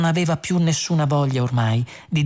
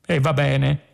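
offended murmur. A narrator's reading. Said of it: "mormorò il computer in tono offeso".

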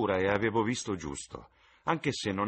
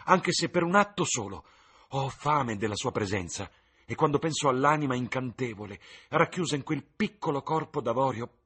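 higher, louder passionate outburst; raised voice of an infatuated young man; cried out; 6 semitones higher, 5 dB louder than usual. Acted. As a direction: burst out with longing. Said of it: "gridò".

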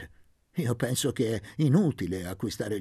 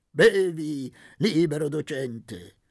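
hearty offer; 4 semitones higher, 6 dB louder, slower than usual. A narrator's reading. Don Benedetto offre vino.

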